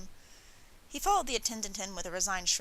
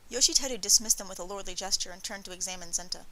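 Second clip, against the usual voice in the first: usual speaking voice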